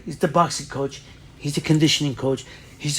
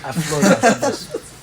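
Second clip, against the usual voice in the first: laughing through the words; giddy laugh over it; 6 semitones higher, 8 dB louder, slower than usual. Real, not acted. Recalling floating off the bus, laughing.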